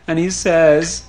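ordinary delivery, nothing marked

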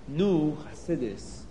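ordinary delivery, nothing marked

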